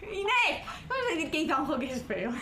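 laughing protest at a friend; laughing outcry. Real, not acted. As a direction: call her name out in shocked amusement. Mock outrage at calling actor ugly.

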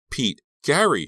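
high, loud call for help; desperate yell, rising on the second name; screamed names. Acted. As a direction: scream out for rescue. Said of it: "she screamed".

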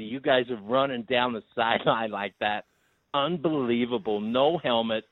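put-on American commentator voice; American accent. Caller doing rugby on request, hammed-up American accent. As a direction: play an amazed American watching rugby. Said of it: "do it in your best American accent".

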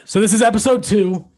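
too loud, right on the mic; yelling into the mic. Real, not acted. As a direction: shout it straight into the microphone. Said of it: "you're yelling into the mic".

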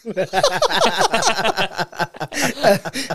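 big loud laugh; long real laugh. Real, not acted. Telling a friend the story, laughing out loud. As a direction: laugh it out hard.

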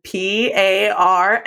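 slow, letter-by-letter delivery; spelling it out. Retold or imitated. Manager spelling parentheses for a rep on a call, slowly and deliberately. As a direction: spell it slowly for a confused listener. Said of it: "Can you spell that".

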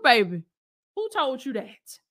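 ordinary delivery

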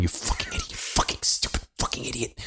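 angry whispered self-scolding; muttering. Retold or imitated. Walking her home, berating himself, muttered very softly. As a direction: mutter curses at yourself under your breath. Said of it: "like, muttering".

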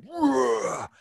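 high, drawn-out roar; imitated roar. Footballer imitating his fierce teammate, long high roar. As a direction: roar it out like a Viking. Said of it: "because he roared at me".

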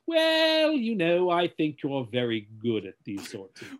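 pompous mock-British thespian; hammy actor giving stately praise; Kelsey Grammer. Shown as a demonstration. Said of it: "talking to Kelsey Grammer".

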